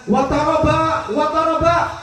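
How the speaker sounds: high, loud, slow rejoicing; dying Bilal corrects his wife, joyfully; joyful cry. Retold or imitated. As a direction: call it out with happiness. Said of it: "mestinya bilang".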